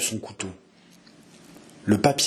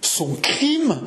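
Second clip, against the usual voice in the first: high, incredulous exclamation; stunned faltering; 10 semitones higher than usual. Acted. Jeanne hearing that marrying her is his crime, stunned faltering. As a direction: echo it in distraught disbelief. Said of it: "balbutia l'infortu".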